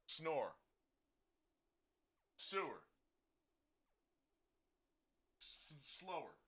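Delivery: voice command demo. Shown as a demonstration. Streamer trying words until the software responds, voice command demo. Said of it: "We're going like this".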